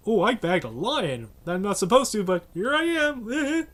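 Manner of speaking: high, loud gloating hunter voice; mock a smug trophy hunter, drawn out; big game hunter. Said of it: "douchebag hunters".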